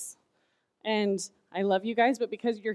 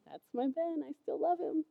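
tender; 4 semitones higher, 9 dB quieter than usual. Retold or imitated. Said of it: "God's still looking at you going".

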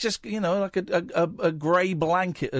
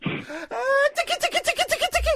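giggly tickle attack. Pretend. Tickling the caller on air.

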